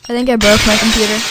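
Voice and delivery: loud, rushed alarm; hammed-up freakout; panicked yell. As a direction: blurt it out in a panic.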